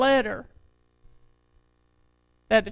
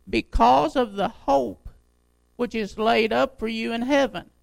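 slow, emphatic pulpit reading; scripture reading; 5 dB louder, slower than usual. Acted. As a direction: proclaim the verse, landing hard on key words. Resumes reading the letter aloud.

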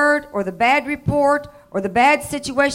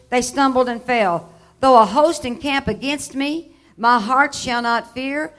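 preaching at full force; 2 semitones higher, 5 dB louder than usual. Acted. Preacher booming the psalm to his congregation. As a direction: proclaim it to the whole room.

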